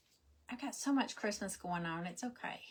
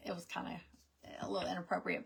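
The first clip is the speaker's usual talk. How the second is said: breathy confiding whisper, voice gone; whispered aside; 2 semitones lower, 6 dB quieter, faster than usual. Real, not acted. Whispering a guest's faux pas to viewers, a whispered aside. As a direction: drop to a whisper for the gossip. Aside about dinner talk at table.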